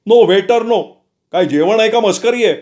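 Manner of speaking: a harried manager losing patience; flustered manager. Acted. Manager protests loudly.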